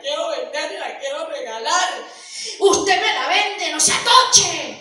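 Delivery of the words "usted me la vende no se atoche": loud haggling. Acted. Father pushing to sell the donkey.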